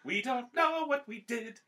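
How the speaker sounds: high, slow mock lament; drawn-out, high-pitched cartoon complaint; Family Guy bit. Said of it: "That's that Family Guy bit".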